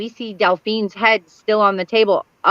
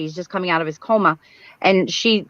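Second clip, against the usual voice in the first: normal talking voice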